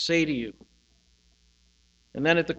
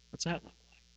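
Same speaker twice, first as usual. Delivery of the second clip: usual speaking voice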